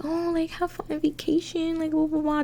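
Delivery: high, sing-song voice for the friends; mocking friends. Narrator mocks her friends in a high, cheery voice. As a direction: mimic their fake-friendly chirp. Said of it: "Crystal and Betty were like".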